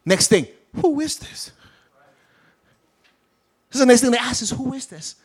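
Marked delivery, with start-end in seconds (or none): 0.8-1.5 high, hushed wonder; awed question. Retold or imitated. Disciples amazed after calm. 4.5-5.2 high, hushed wonder; high soft amazement; awed question. Retold or imitated. Disciples amazed after calm.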